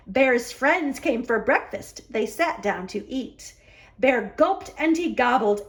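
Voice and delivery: ordinary delivery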